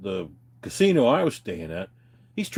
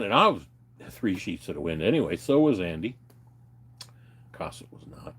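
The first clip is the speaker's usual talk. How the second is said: usual speaking voice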